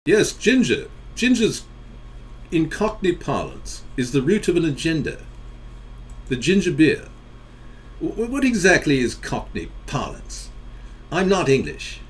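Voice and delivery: a badly faked Cockney accent; horrible Cockney accent. Host jokes about ginger to his viewers in a badly faked Cockney accent. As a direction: ham up a poor Cockney accent. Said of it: "I'm merely putting on a horrible accent".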